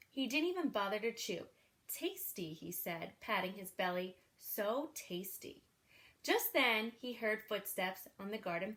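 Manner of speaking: put-on lively read-aloud for children; storybook reading. A narrator's reading. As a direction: read it like a bedtime picture book. Reads a picture book aloud.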